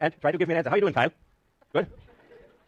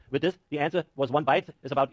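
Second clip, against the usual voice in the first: ordinary talk, nothing marked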